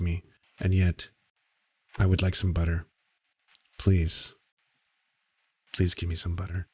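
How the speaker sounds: low, soft, measured mock podcast voice; Sam Harris voice. Streamer mocks Sam Harris's soft podcast voice, low and hushed. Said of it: "Is, is that a podcast voice?".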